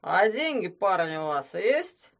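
put-on loud drunken demand. A narrator's reading. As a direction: push him for cash.

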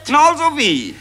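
eager high question. Acted. One gossip presses the other for the answer, high and eager. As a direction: act like the answer is obvious.

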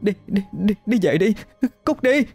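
put-on high, thin, frightened girl's voice; trembling scared plea. A narrator's reading. Hân begging the thing outside the door, frightened. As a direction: push the ghost away in fright. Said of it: "Giọng cô run rẩy".

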